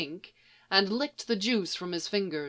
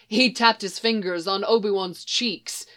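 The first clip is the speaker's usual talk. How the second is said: ordinary delivery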